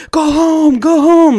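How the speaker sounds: high, loud mock shout of go home; imitating a cop shouting at a kid to get lost; retold cop yelling. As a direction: bark it out like cops yelling from a car. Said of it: "started yelling at me".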